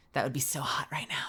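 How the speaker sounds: breathy sultry whisper over food. Pretend. Whispering to the chat about butter, sultry. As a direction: whisper it like a seduction.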